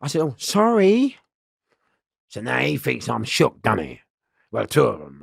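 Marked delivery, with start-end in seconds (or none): 0.2-1.2 high, meek fake apology; playing dumb. Playing Sammy the Peanut to fool the crew, meek high apology. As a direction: act the harmless fool. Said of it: "So I'm playing Sammy".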